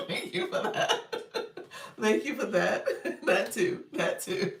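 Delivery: talking through real giggles; laughing. Real, not acted. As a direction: thank them while cracking up. Tickled by a viewer's comment.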